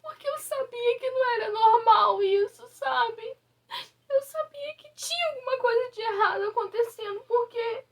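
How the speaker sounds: high, tearful, voice breaking; telling viewers while sobbing; really crying. Real, not acted. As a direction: speak through real tears. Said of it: "Eu não queria chorar nesse vídeo".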